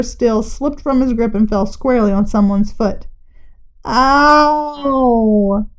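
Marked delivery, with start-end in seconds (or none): 3.8-5.7 pained cry. A narrator's reading. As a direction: yelp as the steel hits the foot. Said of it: "cried Charlie Butcher".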